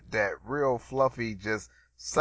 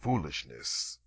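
lower, softer announcer read; deep voiceover; 10 semitones lower, 8 dB quieter, much slower than usual. Shown as a demonstration. Host demoing own podcast voiceover, deep and low. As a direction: intone it like a radio promo. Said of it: "I could probably do my own voiceovers".

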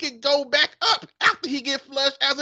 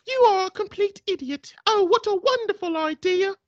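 put-on woman's British accent; 8 semitones higher than usual. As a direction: purr it back like a smitten English lady. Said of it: "man and a woman accent".

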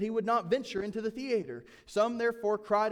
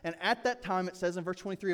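usual speaking voice

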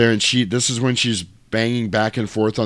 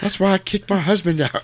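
mock character voice; 10 semitones higher than usual. Joking quip in a voice.

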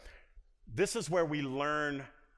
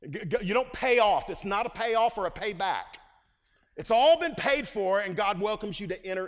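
rising preaching fervor; 6 semitones higher, 8 dB louder than usual. Real, not acted. Preaching climax on grace.

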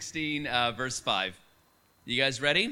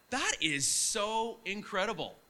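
slow, higher-pitched retold amazement; amazed; 5 semitones higher, slower than usual. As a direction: draw it out in wonder. Retold amazed reaction to the news.

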